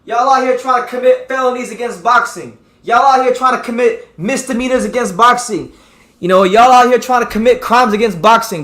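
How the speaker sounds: high, loud riled-up rant; fired up. Real, not acted. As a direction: preach it like a fed-up fan. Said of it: "Got me fired up today".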